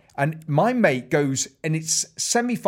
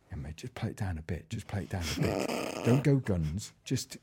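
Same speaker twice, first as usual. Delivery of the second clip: hushed warning; 11 semitones lower, 11 dB quieter than usual. Retold or imitated. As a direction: murmur it low and quiet, a friend leaning in. Urging mate quietly in bar.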